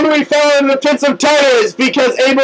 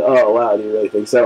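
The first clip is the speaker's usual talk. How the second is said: much lower, soft, lazy devil voice; deep Hades voice; 3 dB quieter, faster than usual. Pretend. Hades greeting the fallen friends, in a much lower, softer voice. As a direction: introduce yourself as the lord of the underworld, low and smooth.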